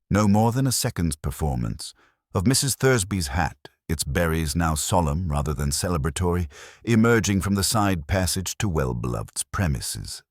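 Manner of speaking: ordinary delivery, nothing marked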